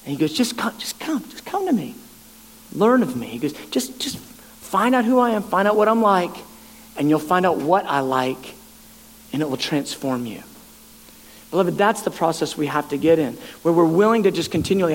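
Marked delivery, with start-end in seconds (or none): 0.3-3.3 soft and hushed, inviting; gentle voice of God. Retold or imitated. Preacher voicing God's gentle call, hushed and tender. 3.7-10.4 softer and lower, fading away; gentle voice of God. Retold or imitated. Preacher voicing God inviting believers, softly fading lower.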